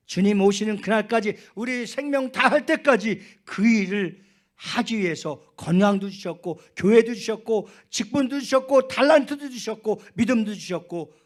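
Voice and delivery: high, very loud sermon crescendo; impassioned preaching. Real, not acted. Pastor marvelling at an elder's words, fired-up preaching. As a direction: preach it at full force, pitch held high.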